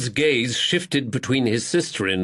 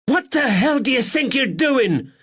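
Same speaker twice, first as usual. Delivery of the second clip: high, loud furious yell at the brothers; furious landlady; 4 dB louder than usual. Acted. Landlady screams at the two fighting brothers, furious. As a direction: screech it like an outraged landlady. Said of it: "The furious landlady".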